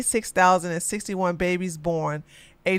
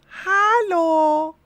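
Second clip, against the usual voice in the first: high hello; 10 semitones higher than usual. Acted. Greeting stream in high voice.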